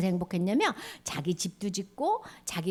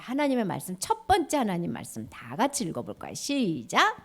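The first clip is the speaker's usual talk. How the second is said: ordinary delivery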